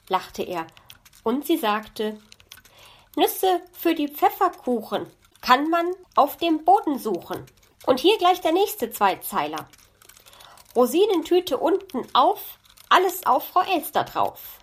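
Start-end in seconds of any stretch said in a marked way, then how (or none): 3.1-14.6 high, lively magpie voice in verse; proud magpie reciting. A narrator's reading. Magpie shows off two couplets to fox, proudly reciting.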